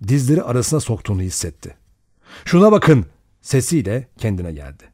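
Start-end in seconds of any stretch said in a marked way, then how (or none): 2.4-3.0 raised, higher exclamation; startled call. A narrator's reading. Man showing the others the seaweed, startled shout.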